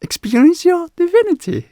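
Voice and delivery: very high, loud, over-the-top announcer welcome; hammed-up erratic event host greeting; erratic hyped host. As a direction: ham it up like a frantic showman. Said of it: "speak like this".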